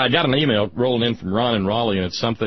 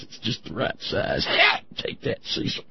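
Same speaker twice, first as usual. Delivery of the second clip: gloating whisper; 2 semitones higher, 4 dB quieter than usual. Pretend. Imagined gloating by Blankenship.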